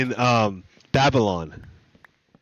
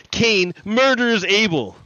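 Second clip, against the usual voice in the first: normal talking voice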